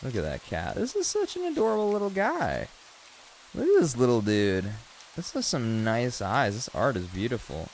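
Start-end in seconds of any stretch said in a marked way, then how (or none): 0.8-4.7 very high, gushing coo; cooing over cute cat. Real, not acted. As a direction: coo high at something adorable.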